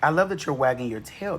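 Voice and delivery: ordinary talk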